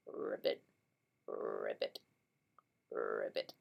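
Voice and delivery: deep creaky frog croak; croaky frog. Shown as a demonstration. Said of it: "frog sounds creaky and croaky with his ribbiting".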